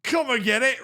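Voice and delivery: half-baked Scottish hard man; failed Scottish accent. Acted. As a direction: goad him in a badly faked Scottish accent. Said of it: "I'm not doing a Scottish accent".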